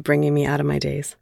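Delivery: plain reading voice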